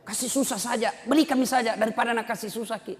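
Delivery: high, loud scolding rant; angry mother. Retold or imitated. An angry mother scolds her husband over the child. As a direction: berate the husband. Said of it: "marah mamanya".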